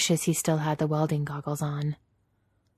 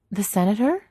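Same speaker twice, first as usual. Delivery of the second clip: higher, louder startled question; surprised; 6 semitones higher, 5 dB louder than usual. A narrator's reading. Leia learning who her mother was, surprised. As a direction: ask it in surprise, pitched up.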